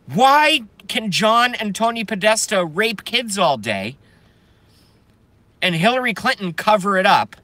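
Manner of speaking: high-pitched, indignant outburst; angry rant. Real, not acted. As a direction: lash out at your harassers, voice pushed up. Angry political rant at harassers.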